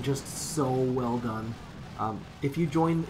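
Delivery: ordinary delivery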